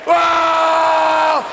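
hammed-up scream of a woman in labor; mock labor scream.

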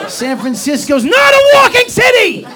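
very high, loud screamed complaint; comic screaming tantrum; furious shout. Acted. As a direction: explode in hammed-up outrage. Acted furious argument with wife.

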